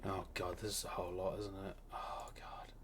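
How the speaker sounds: hushed, overwhelmed murmur; whispering. Real, not acted. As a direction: breathe it out to yourself. Overwhelmed muttering to himself.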